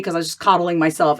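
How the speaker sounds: usual speaking voice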